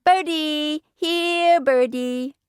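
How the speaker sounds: put-on high, singsong toddler call; little boy calling. A narrator's reading. Fudge chasing pigeons in the park, calling out. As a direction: draw it out high to lure the birds. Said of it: "he called".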